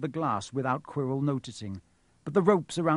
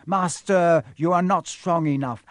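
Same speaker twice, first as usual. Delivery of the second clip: loud, higher-pitched plea; urgent protest; 4 semitones higher, 7 dB louder than usual. A narrator's reading. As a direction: protest to your master urgently. Quirrell anxiously protests to his master.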